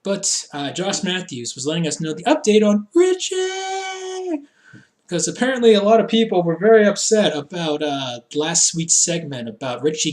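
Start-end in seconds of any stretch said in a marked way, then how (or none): 2.9-4.4 high, drawn-out mock announcement; hammed-up name call. Host announcing an update on an injured wrestler, hammed-up call. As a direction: stretch the name out, pitched way up.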